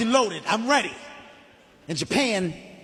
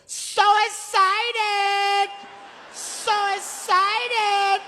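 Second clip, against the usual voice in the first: very high and drawn out, much slower; high slow mock Japanese voice; 12 semitones higher, much slower than usual. To the narrator on the ride, very high slow "So excited". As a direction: stretch "So excited" high and slow like an overeager stranger. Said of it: "He goes".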